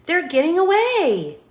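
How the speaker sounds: high, loud alarm; alarmed narration. A narrator's reading. Narrator warns listeners, high and loud alarm. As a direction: raise the alarm. Bad guys fleeing.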